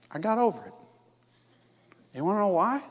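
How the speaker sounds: ordinary delivery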